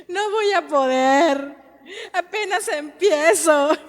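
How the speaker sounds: slow, half-crying, half-laughing retelling of her own despair; tearful lament. Retold or imitated. Mother retelling her despair to her husband, tearful and slow. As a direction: relive the moment you broke down, voice wobbling.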